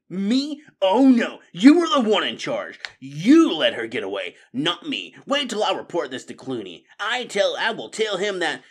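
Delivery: loud, high protesting outburst; indignant blame. A narrator's reading. Crony threatens to report his officer, indignant. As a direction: throw the accusation straight back. Accused rat throws blame back.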